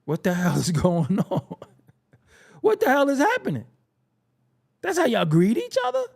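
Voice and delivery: high-pitched disbelieving outburst; escalating, squeaky-high incredulity; incredulous. Acted. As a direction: react in comic shock, voice climbing. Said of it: "And I'm like".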